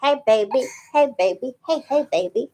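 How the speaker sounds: cooing. Real, not acted. Cooing at the baby.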